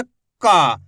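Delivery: का said with force, aa drawn out long; teacher showing a forceful, long का; stressed long aa. Shown as a demonstration. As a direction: lean into का and stretch the aa. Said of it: "थोड़ा जोर लगा के पढ़ा".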